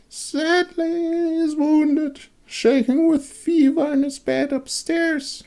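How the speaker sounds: high raspy crone drawing out her words. A narrator's reading. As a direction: creak it out like an old hag. Crone voice again.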